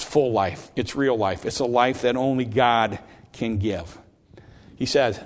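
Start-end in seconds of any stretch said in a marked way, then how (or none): none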